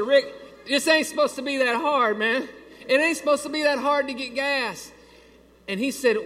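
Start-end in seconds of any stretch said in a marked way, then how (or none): 0.0-4.9 higher, louder pleading protest; exasperated complaint. Retold or imitated. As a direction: plead that it shouldn't be this hard. Complaining to the attendant.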